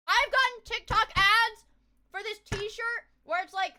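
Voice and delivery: loud and drawn out; loud excited. Real, not acted. Excited rant about ads.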